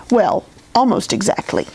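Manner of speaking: ordinary delivery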